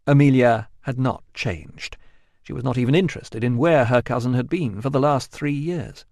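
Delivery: ordinary delivery, nothing marked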